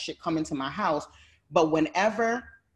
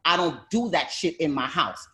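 retold angry retort. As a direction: snap back at her, then blow up. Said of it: "I was like".

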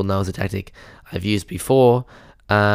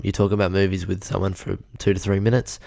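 ordinary talk, nothing marked

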